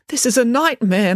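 usual speaking voice

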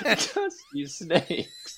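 hammed-up drawn-out DJ sign-on; mock DJ name. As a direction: stretch it out like a radio ident. Trying the DJ name on air.

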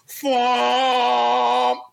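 long sustained car-horn blare; imitated powerful horn. Mini fed up at the light, long horn blast. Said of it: "Mini had a more powerful horn".

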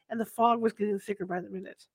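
ordinary delivery, nothing marked

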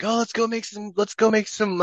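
lightly voiced friends, a high eager pitch; mock eager friends. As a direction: pitch it up like excited buddies. Friends quoted suggesting burgers.

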